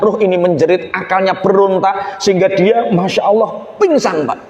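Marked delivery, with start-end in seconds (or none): none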